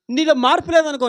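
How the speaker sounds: usual speaking voice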